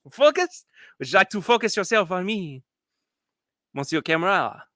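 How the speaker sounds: playful fake French accent. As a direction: flirt with the camera like a hammed-up Frenchman. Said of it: "It's my French accent".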